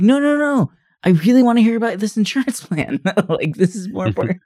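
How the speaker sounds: very high, hurried protest with giggles; mock insistence. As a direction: insist absurdly in a squeaky voice, half laughing. Comedian insists on hearing the plan.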